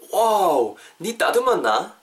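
drawn-out wow, then a high question; acted amazement. Teacher playing a friend who spots changed eyebrows, acted amazement. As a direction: act impressed by a friend's new look. Acting out noticing trimmed eyebrows.

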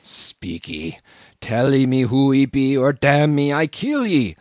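a hint of a growling cannibal; menacing, half-voiced savage threat; growled threat. A narrator's reading. Cannibal threatening the narrator, mildly growled. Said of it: "again growled the cannibal".

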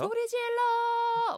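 high, long crowd-rousing yell; rock festival shout. Acted. Singer to a rock festival crowd, shouted. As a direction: holler it out and stretch the end. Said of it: "그 락 페스티벌 가면".

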